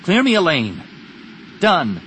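high, loud battle command; urgent battle call. Acted. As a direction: call it out like a pilot in combat. Urgent battle dialogue in story.

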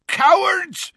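loud, high furious bellow. A narrator's reading. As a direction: bellow across the grounds. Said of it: "bellowed Hagrid".

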